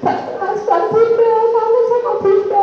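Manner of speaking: put-on high, tearful child's voice, a touch of a sob; imitating crying child. Child phoning mother from boarding school, tearful and high. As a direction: voice a homesick child crying on the phone. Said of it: "Pernah telepon itu nangis".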